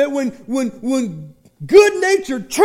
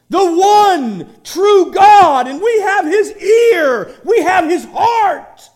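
full-force preaching; 7 semitones higher, 8 dB louder than usual. Acted. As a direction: proclaim the name of God at full force. Preaching climax to congregation.

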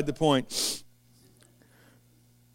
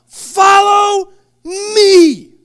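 slow, high and loud summons; Jesus calling the tax collector, slow and loud; commanding call; 13 semitones higher, 10 dB louder, much slower than usual. Acted. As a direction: call him out with full voice. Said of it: "he says what?".

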